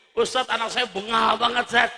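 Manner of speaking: mock-whining parent to the preacher; complaining parent. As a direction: imitate a fed-up parent complaining.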